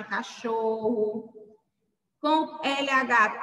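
ordinary delivery

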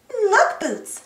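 high-pitched call to a friend. A narrator's reading. Dora points Boots to a boat, calling. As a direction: mildly call for attention. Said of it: "called Dora".

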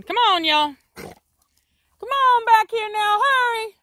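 high, loud, drawn-out call; calling dogs. Real, not acted. Trainer calling the dogs back inside, high loud slow call. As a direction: stretch the words out to call the pack home.

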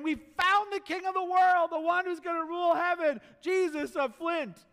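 high, loud proclamation, slowing on "Jesus of Flint"; excited announcement. Acted. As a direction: announce the king with wonder. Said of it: "someone saying".

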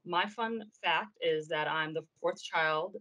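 usual speaking voice

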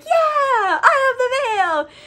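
high, squealing triumph; freaking out. Acted. Dora freaking out about the veil, ecstatic cry. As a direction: crow it in a hammed-up high-pitched frenzy. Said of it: "like, freaking out about it".

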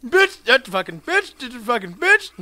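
high, loud burst of swearing; cursing outburst. Pretend. Player attacking game enemy, loud high cursing outburst. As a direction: spit out repeated curses high and loud. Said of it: "I apologize for my outburst".

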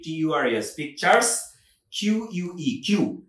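usual speaking voice

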